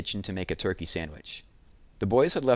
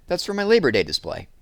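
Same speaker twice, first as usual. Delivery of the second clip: higher, louder indignant protest; indignant girl; 6 semitones higher, 6 dB louder than usual. Acted. Jessie telling Evan the foam core is hers, raised and loud. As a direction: defend what's yours. Jessie refusing her brother.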